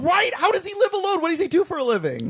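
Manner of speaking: high-pitched, laughing disbelief; real incredulous questioning, pitched high; amused incredulity. Real, not acted. Incredulous questioning about character's living situation.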